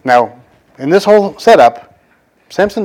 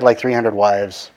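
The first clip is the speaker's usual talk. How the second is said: ordinary talk, nothing marked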